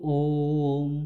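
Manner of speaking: "ओम" chanted very slowly, held far beyond normal; long drawn Om. Shown as a demonstration. Teacher chanting Om to show the three-beat vowel. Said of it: "जिसमें तीन मात्राओं का समय लग जाता है".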